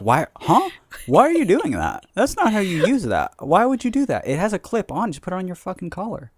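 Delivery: put-on baffled elder scolding the young; exasperated old person. Ranting at TikTokers about the lav mic, incredulous. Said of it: "be like".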